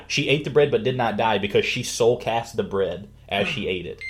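usual speaking voice

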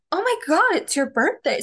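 high gasped start, dropping back down; mock sudden realisation. Imitating mom remembering daughter's birthday late, surprised. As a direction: imitate someone suddenly remembering, pitch leaping.

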